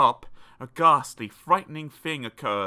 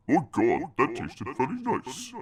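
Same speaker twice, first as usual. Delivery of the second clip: giant muttering. A narrator's reading. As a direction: savour the meal under the breath. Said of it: "He heard the giant mutter".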